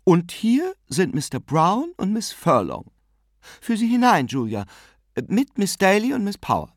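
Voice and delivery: high-pitched, bustling hostess; brisk, summary. A narrator's reading. Aunt hands guests over to her sister, brisk. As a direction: dispatch the introductions in one go. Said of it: "sagte Tante Kate summarisch".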